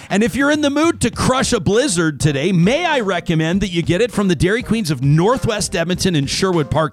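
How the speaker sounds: bright, upbeat live ad read; radio ad read. Acted. Host reading a live Dairy Queen spot to listeners in an upbeat ad voice. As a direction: sell the Dairy Queen specials with a smile in the voice.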